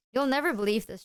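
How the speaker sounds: ordinary talk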